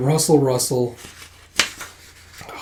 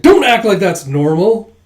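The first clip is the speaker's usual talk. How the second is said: exasperated retort; 6 semitones higher, 7 dB louder than usual. Acted.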